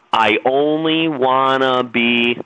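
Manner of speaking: slow, stretched-out I only wanna be; drawn-out line. Shown as a demonstration. Preacher slowly leading the crowd through the line. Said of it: "You're gonna say it with me".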